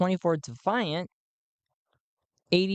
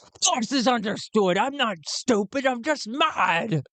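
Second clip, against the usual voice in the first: angry shout; 6 semitones higher than usual. Acted. Madman snaps back angrily.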